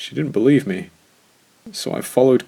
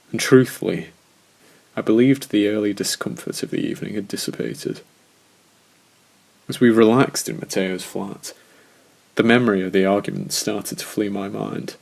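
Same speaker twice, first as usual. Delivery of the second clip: ordinary delivery, nothing marked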